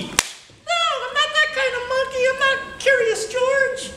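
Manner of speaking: high monkey voice, slowing at the end; a sassy little monkey setting him straight; talking monkey. Acted. Monkey corrects the boy.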